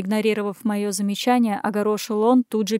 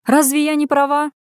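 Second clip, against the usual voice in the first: higher, louder indignant question; indignant outburst, raised in pitch; 6 semitones higher, 6 dB louder than usual. A narrator's reading. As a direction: protest that you are right. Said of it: "возмутилась Лена".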